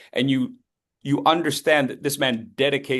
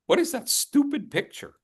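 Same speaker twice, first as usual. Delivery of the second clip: puzzled audience voice; 12 semitones higher than usual. Retold or imitated. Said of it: "Now you're all wondering".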